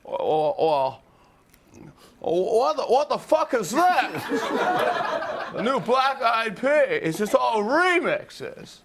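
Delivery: high, drawn-out stammering outburst; Jimmy Stewart. Acted. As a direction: stretch the words in a cranky, high-pitched stammer. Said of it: "we would do Jimmy Stewart just reviewing albums".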